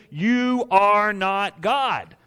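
very slow, each word weighted; slow emphatic. Acted. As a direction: drop it slowly and heavily. Sermon punch line.

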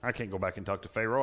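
usual speaking voice

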